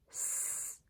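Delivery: seed stretched slowly into S, E, ed; stretched word. Shown as a demonstration. Teacher stretches seed for the class, slow and drawn out. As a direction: pull seed apart, sound by sound. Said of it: "One more time".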